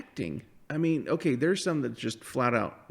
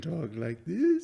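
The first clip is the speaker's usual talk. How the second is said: slow, swooping mock-theatrical drawl; exaggerated imitation of a melodramatic actress; over-the-top Credence; 5 semitones higher, slower than usual. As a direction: stretch the words out in a hammed-up voice. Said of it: "over the top".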